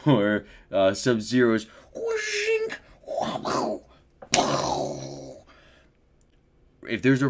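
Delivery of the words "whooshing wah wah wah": whoosh noise. Retold or imitated. Imitating Sub-Zero's attack sound.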